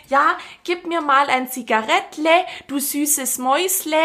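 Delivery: gespielter schwäbischer Dialekt, höher; Schwaben-Klischee. Retold or imitated. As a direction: ein Klischee-Schwabe bettelt um eine Zigarette. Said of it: "Was sagt der?". (übertriebenes Schwäbisch, leicht gespielt)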